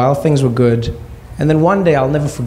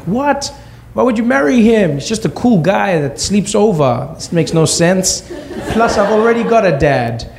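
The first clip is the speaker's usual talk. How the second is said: put-on high, disbelieving child voice; incredulous kid; 8 semitones higher than usual. Young son to his mom, baffled and high-pitched. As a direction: squeak out the objection in disbelief. Kid reacts to mom's marriage news.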